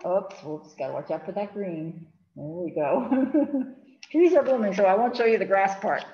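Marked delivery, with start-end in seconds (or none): none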